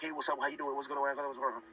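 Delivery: rattled off in one blurred rush; too-fast babble. Shown as a demonstration. Teacher showing learners how not to just speak fast, babbled. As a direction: race through it, words tumbling together. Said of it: "not to only just speak fast, like".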